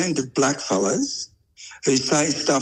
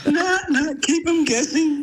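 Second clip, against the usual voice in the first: laughing; 11 semitones higher than usual. Real, not acted. Laughing catchphrase.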